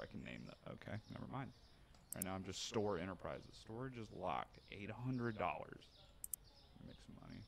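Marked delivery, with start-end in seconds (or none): none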